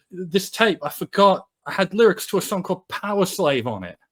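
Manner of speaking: high-pitched, loud retold excuse; acted-out flustered excuse; urgent explaining. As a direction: blurt out an apologetic explanation. Bruce anxiously explains himself.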